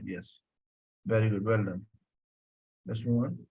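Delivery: ordinary delivery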